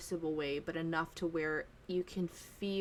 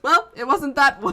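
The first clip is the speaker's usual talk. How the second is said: put-on much higher, much louder wry old wandmaker; wand shop keeper; 7 semitones higher, 15 dB louder than usual. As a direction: quip it up high and loud like a dotty old wizard. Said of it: "And he goes".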